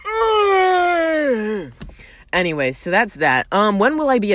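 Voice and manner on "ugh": long, high straining groan; hammed-up aching getting-up noise; exaggerated groan. Thirty-year-old comic imitating standing up, long mock groan. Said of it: "without going".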